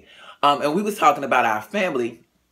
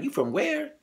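retold surprise; 5 dB quieter than usual. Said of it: "He was just like".

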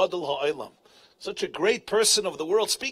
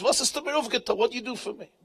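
normal talking voice

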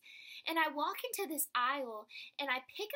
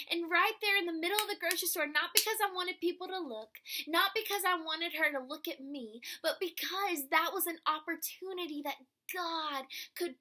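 louder, rising fervour; impassioned preaching; 4 semitones higher, 7 dB louder than usual. Real, not acted. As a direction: preach it out with passion. Preaching build up.

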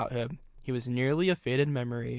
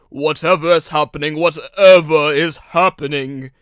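loud moaning; 13 dB louder than usual. A narrator's reading. Engine stopping outside the tunnel in trouble, loud moan. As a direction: moan it loudly, stretching the repeat. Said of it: "he moaned as he came".

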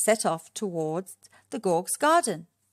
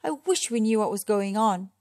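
plain reading voice

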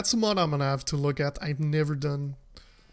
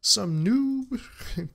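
high, hammed-up indignation; mock-arrogant streamer reply to chat; mock offended; 7 semitones higher, slower than usual. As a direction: play insulted to tease the chat.

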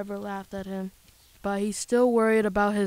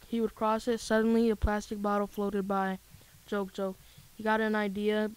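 ordinary delivery

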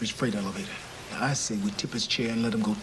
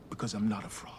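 whispered; 3 semitones lower, 6 dB quieter than usual. Acted. Quiet confession.

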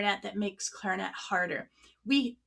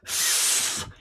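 quick, forceful burst of air blown out; fast breath. Shown as a demonstration. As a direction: blow out a short, fast stream of air. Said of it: "this is what fast air sounds like".

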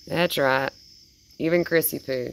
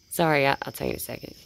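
usual speaking voice

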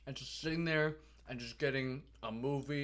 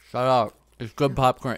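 loud mock-offended outburst; loud, joking protest; playful shout; 16 dB louder than usual. Real, not acted. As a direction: yell it back in fake outrage. Friend teases him about floor popcorn.

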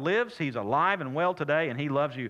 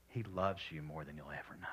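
hushed tender; 11 semitones lower, 15 dB quieter than usual. Real, not acted. Tender climax about God's love.

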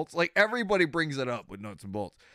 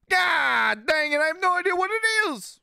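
frustrated shout; 6 dB louder than usual. Real, not acted. Streamer yelling after missing the new duck, exasperated shout. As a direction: shout it out in comic despair.

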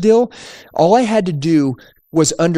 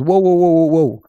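higher-pitched mock objection, run of whoas; imitating interrupting customer; 3 semitones higher, 3 dB louder than usual. Customer stopping a salesman who takes over his deal, mock protest. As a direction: cut in to halt the takeover, acting it out.

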